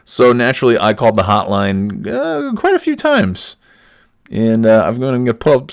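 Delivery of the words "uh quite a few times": sudden high, slow and coy; mock innocent. Real, not acted. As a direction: play it innocent, drawn out. Admits calling the hotline repeatedly.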